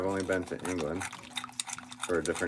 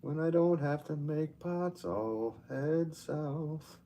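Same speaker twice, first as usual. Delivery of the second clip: wistful daydream; 9 semitones higher, much slower than usual. Real, not acted. Daydreaming about travelling south.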